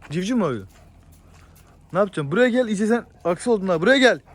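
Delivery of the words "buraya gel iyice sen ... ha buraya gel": higher, louder call building to a sharp command; calling out sharply. Real, not acted. Owner calling his grumpy animal over, loud and insistent. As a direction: summon the stubborn animal, raising the voice.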